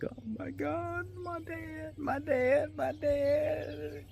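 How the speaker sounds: high squeaky tearful voice, slow and soft; imitating crying daughter. Dad imitating his daughter crying happily over the proposal question. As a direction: mimic a little girl sobbing with happiness. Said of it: "And she started crying".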